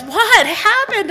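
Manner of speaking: high, slow, puzzled husband voice; mock innocent husband. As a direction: play the clueless husband asking, drawn out. Husband voiced after door slam.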